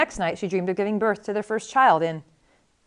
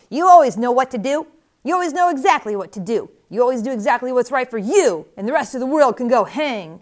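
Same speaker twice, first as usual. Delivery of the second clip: raised, loud reproach; accusing baby; 3 semitones higher, 5 dB louder than usual. A narrator's reading. Dream baby berates Buttercup for her selfishness, loud accusing voice. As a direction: lay into her with rising indignation.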